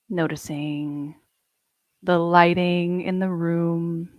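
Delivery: calm, unhurried meditation guide with long pauses; guided meditation. Acted. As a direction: ease the listeners into their bodies, gently.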